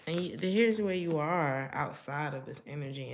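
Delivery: normal talking voice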